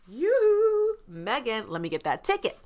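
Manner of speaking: squealed high You then eager rush; eager grab. Retold or imitated. To Megan, grabbing her spare ticket: high eager yelp. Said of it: "I was like".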